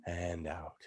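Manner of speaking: very soft, drawn-out cue to breathe out; soft guided out breath. Shown as a demonstration. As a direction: breathe it out gently, almost a whisper. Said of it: "we do that a couple times more".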